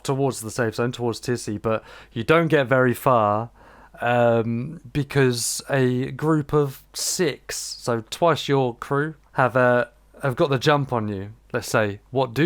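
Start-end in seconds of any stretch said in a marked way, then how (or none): none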